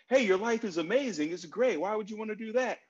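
high, bright pep-talk push, fixing it; mock fix-it reassurance. Friend trying to fix a suicidal person's feelings, mock upbeat. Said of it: "our tendency is to want to fix it".

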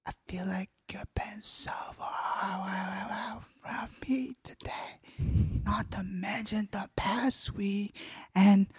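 breathy close whisper; ASMR whisper. Acted. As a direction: whisper intimately into the mic. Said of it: "Do more, like, A S M R stuff".

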